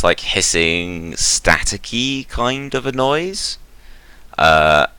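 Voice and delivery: ordinary delivery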